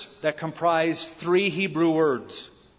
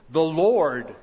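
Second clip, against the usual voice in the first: "Lord" leaned on, louder and held; stress on Lord; 4 dB louder than usual. Shown as a demonstration. Preacher pointing out the name Lord, stressing "Lord". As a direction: hit the word "Lord" hard. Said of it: "the word Lord appears".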